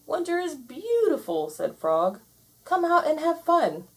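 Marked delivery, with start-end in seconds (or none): 0.1-1.5 high, bright-eyed Frog; high Frog voice, delighted; Frog's voice. Acted. Frog praising winter. 2.6-3.9 high coaxing Frog voice. Acted. Frog coaxing Toad outside.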